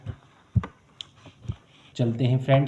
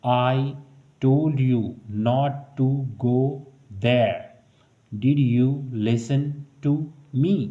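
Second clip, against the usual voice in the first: very slow, word by word; slow teaching read; slow drill; much slower than usual. Shown as a demonstration. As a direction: read it slowly so learners copy. Slow model English sentence.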